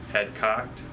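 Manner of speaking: ordinary delivery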